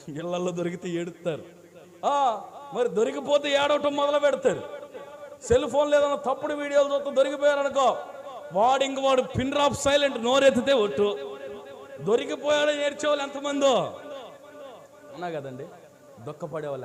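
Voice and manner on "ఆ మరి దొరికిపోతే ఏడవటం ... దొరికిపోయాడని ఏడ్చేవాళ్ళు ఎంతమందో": high, loud preaching of the tale; a preacher's story at full force; loud sermon storytelling. Acted. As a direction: drive the story home from the pulpit.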